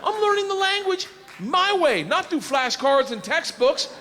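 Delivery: high, loud and laughing; excited. Real, not acted. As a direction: boast it with giddy glee.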